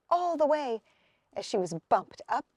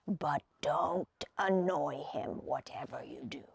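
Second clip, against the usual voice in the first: whispered warning; 10 semitones lower, 10 dB quieter than usual. A narrator's reading. Old man warns Hazel about the troll, whispering. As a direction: hush it like a secret warning.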